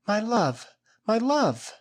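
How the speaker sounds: high woman's voice crying out. A narrator's reading. The girl rushing to Esteban, crying out in love. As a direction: call to your lover with open arms. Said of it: "she cried".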